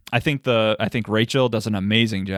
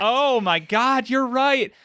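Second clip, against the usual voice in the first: excited realization; 11 semitones higher, slower than usual. Real, not acted. Realizing the cat is best.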